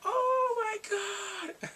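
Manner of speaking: very high, drawn-out squeal; high-pitched fangirl wail; gushing squeal. Retold or imitated. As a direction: swoon loudly, stretching every word out. Reacting to romantic hair brushing scene.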